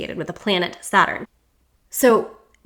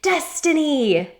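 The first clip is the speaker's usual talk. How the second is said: slow, higher drawn-out cry; shouting skyward; 7 semitones higher, slower than usual. A narrator's reading. The boar shouting skyward with its snout up, slow and high. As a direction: call it out to the sky.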